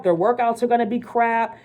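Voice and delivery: normal talking voice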